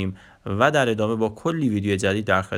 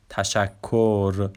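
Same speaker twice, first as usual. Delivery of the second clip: normal talking voice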